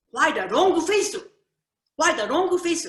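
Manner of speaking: put-on Japanese-accent English, wrong said for long. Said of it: "he can't say the L".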